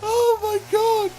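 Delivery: slow, high, laughing oh my god; laughing disbelief. Real, not acted. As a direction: drag it out through laughter. Laughing at the carnage.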